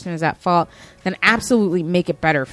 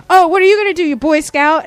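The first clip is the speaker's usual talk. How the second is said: much higher and louder mocking shriek; imitated screaming; 9 semitones higher, 8 dB louder, faster than usual. Quoting the customer taunting the police officer, mock screaming. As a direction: scream it at the cop with contempt.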